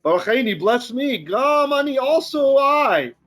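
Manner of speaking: high, rising cry ending drawn out; acted wail, building to a long last word; anguished outburst. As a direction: blurt it out as an anguished plea. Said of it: "sense the anguish in Esau as he's blurting this out".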